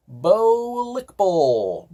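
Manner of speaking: Bohliqubl stretched out very slowly, syllable by syllable; mock-grand pronunciation of Bohliqubl; drawn-out brand name. Shown as a demonstration. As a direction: sound out Bohliqubl slowly and carefully, as if tasting the odd word. Reads odd brand name off bottle.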